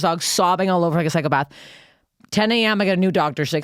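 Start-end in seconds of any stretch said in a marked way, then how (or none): none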